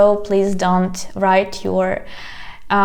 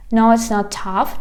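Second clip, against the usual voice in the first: normal talking voice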